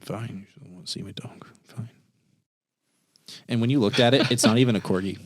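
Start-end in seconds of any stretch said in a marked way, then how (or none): 0.0-1.9 disappointed hushed sulk; sulky whisper. Acted. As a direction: whisper it, hurt and sulking. Sulking after refusal, whispered.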